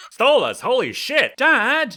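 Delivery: startled outburst; shocked cry. A narrator's reading. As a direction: yelp in alarm.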